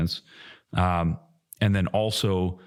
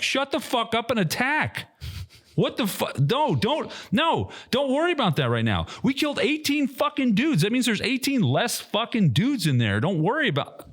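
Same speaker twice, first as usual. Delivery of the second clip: retold angry retort; 13 semitones higher than usual. Retelling his angry radio reply.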